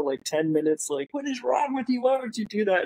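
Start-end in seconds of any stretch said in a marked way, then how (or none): 1.1-2.8 high-pitched mock scolding; imitated yelling. Janitor yelling at the friend, high mock outrage. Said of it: "yelling at him".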